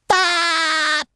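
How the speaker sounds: long, high cartoon yell on one note; drawn-out cry. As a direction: break off into a held "aah". Breaks off imagining with a cry.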